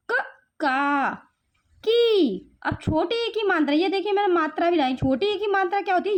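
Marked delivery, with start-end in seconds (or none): 0.1-2.6 क and का stretched out very slowly; slow syllable drill. Shown as a demonstration. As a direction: draw out each syllable for a child. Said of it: "spelling syllables for children".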